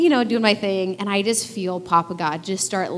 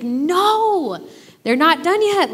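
higher, louder mock exasperation; exasperated no; 9 semitones higher, 3 dB louder, much slower than usual. Mom to daughter asking again, exasperated. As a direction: push the no up in fond frustration.

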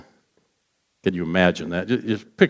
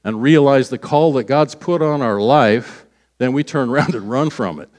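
ordinary delivery

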